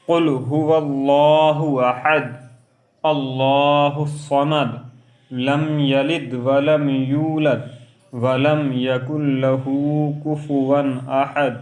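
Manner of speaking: slow, melodic recitation; Quranic recitation. Shown as a demonstration. Teacher reciting Surah Ikhlas in prayer, melodic and slow. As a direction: chant each verse slowly, stretching the vowels.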